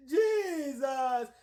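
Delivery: imitating a loud, high, very slow sobbing wail; mock dying wail. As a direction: wail like a man sure he's about to die. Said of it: "And he said".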